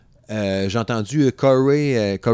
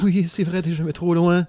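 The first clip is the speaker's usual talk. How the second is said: teasing; 10 semitones higher, 4 dB louder than usual. Acted. Taquine sa partenaire.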